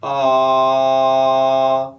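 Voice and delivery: loud, sustained open "ah" on one note; long, level vowel as in car; back-placed "ah". Shown as a demonstration. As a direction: let "ah" vibrate in the back of the mouth. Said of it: "vibrating in the back of your mouth".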